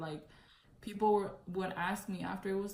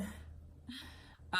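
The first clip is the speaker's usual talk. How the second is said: quiet chuckle; 5 semitones higher, 8 dB quieter than usual. Real, not acted. Laughs at her own hair highlight.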